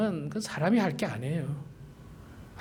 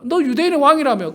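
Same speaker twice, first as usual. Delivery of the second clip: higher, sneering jeer; mocking taunt; 7 semitones higher than usual. Preacher imitating a mocking crowd, higher jeer. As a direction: jeer at the so-called king. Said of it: "뭐 조롱했습니까?".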